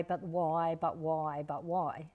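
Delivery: mock a nagging kid repeating why; a touch of a pestering child; whiny why. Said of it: "don't go with the why".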